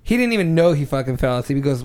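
ordinary talk, nothing marked

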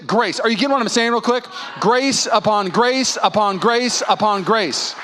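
building refrain, each grace answered; rhythmic preaching. Acted. Pastor to congregation, rhythmic call of grace. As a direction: preach the refrain wave after wave.

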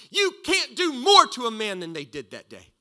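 loud, emphatic proclamation; preaching climax. Real, not acted. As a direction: drive it home to the congregation.

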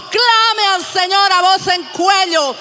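slow, shouted command to cry out; shouted exhortation. Acted. Preacher urges the church to cry out, shouting. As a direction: rouse the congregation with a shout.

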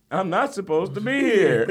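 mock old man. Voicing old man in fire.

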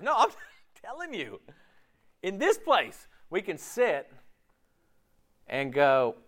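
ordinary talk, nothing marked

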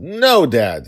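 put-on high, loud whiny kid; a dad playing his pouting child; childish protest.

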